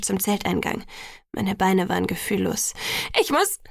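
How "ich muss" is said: high, desperate false start; frantic. A narrator's reading. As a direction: blurt it high, then break off. Frantic, cannot finish the sentence. (verzweifelt und hoch, abgebrochen)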